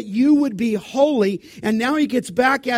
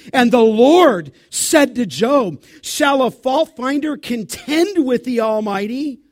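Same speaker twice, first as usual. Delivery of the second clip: loud, rising scripture read; full-voiced pulpit reading; preaching at full force; 4 semitones higher, 8 dB louder than usual. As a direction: preach it out to the whole church.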